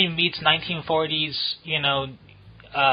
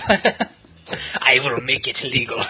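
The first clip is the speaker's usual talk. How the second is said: slow, grand imperial decree; imperious emperor; 2 semitones lower, much slower than usual. Acted. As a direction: declare it slowly like a tyrant emperor. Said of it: "it feels like commands you go".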